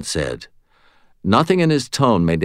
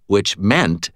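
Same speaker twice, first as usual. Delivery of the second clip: ordinary delivery, nothing marked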